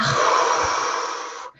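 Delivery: hard gust of breath out; mock gusty exhale; forceful blowing breath. Shown as a demonstration. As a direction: blow out like wind trying to knock something over. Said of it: "like the wind trying to knock something over".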